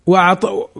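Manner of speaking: usual speaking voice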